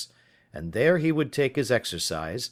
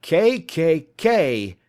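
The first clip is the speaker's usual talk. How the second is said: much higher and louder, very slow letters; terrified shriek; 7 semitones higher, 8 dB louder, much slower than usual. Acted. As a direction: shriek the letters in sheer terror. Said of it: "he shrieked".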